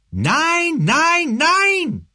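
high furious roar of refusal. Acted. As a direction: bellow it in helpless rage. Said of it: "brüllte er".

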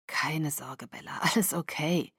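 soft, whispery, strained comfort; hushed, weak voice; whispered reassurance. A narrator's reading. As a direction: reassure her in a near whisper. Hurt Seth reassures Bella.

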